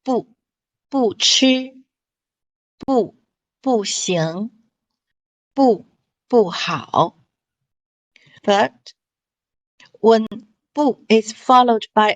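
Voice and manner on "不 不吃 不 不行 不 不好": 不吃 不行 不好 with 不 kept in a falling fourth tone; unchanged 不 demo. Shown as a demonstration. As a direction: read each 不 falling: bù. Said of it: "it keep unchanged".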